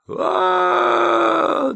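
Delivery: very high, loud, long wail; a shrill unhinged roar; wild howling scream. A narrator's reading. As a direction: howl like a madwoman. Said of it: "дурным голосом заревела".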